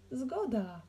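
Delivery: lifted, brisk agreement; mild shout. A narrator's reading. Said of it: "krzyknął Kacper".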